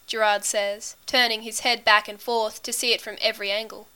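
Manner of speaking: usual speaking voice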